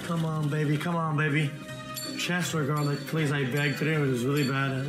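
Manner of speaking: ordinary talk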